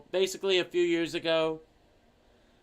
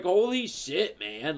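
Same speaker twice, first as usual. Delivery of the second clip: ordinary talk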